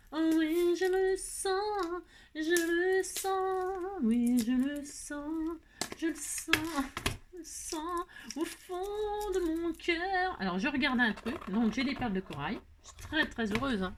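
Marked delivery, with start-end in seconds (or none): 0.1-10.3 high, very slow, theatrical foreboding; melodramatic prophetess. Pretend. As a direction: stretch every word like a seer. Melodramatic premonition, drawn out.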